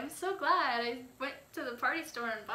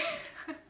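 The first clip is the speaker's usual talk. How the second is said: high giggle; 14 semitones higher, 3 dB quieter than usual. Real, not acted. End of video.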